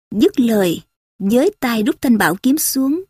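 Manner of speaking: plain reading voice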